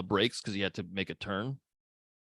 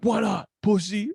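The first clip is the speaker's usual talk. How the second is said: higher, lightly imitated goading; touch of mock belligerence; mock taunt; 8 semitones higher, 4 dB louder than usual. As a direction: taunt like a road-rage bully, playfully. Acts out road-rage taunt.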